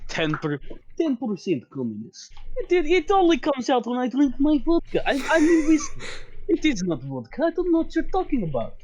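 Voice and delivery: high-pitched, hammed-up Russian accent; overdone Russian denial, laughing through; terrible Russian accent. Sig swearing it's whiskey in a terrible Russian accent. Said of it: "Just terrible Russian accent".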